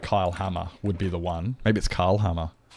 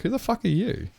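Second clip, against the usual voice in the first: high, indignant mock yell at a stranger; mock outrage; 11 semitones higher than usual. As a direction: pitch it up like an offended stranger squawking. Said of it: "it'd be like".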